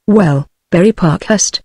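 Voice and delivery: ordinary delivery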